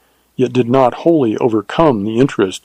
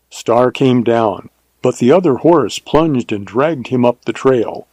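plain reading voice

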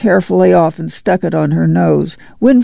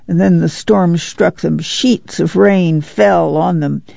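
plain reading voice

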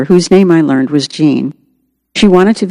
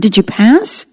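raised-pitch, mildly anxious question; concerned; 5 semitones higher, slower than usual. A narrator's reading. Husband asking his tearful wife about the test, mildly concerned. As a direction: ask with a touch of worry, pitch lifting.

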